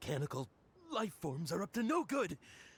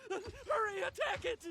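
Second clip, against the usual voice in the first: much higher, frightened cry; alarmed, urging others to attack; scared man yelling at a monster; panicked alarm; 12 semitones higher than usual. Acted. Sees Krabby, panics.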